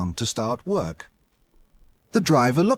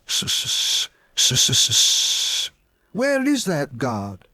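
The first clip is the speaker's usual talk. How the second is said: impatient steam hiss then slow worried question; anxious engine; much slower than usual. Acted. Edward waiting for the guard, anxious hiss and slow question. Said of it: "Edward was getting anxious".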